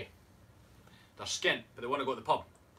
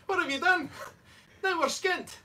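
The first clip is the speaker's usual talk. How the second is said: very high, louder Irish protest; mock Irish accent; 5 dB louder than usual. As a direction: exclaim in a put-on Irish voice. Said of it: "And Murphy's like".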